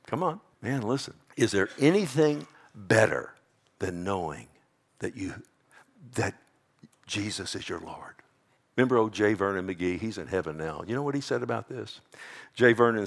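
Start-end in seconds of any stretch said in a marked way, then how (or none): 3.8-8.2 almost breathing the words to the congregation; hushed whisper. Real, not acted. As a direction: drop to a soft whisper. Preacher drops to hushed intimacy.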